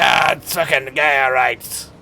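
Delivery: drawn-out mock shout in a hammed-up Scottish accent; badly faked Scottish yell, slow and thick. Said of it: "I can't do the goddamn accent".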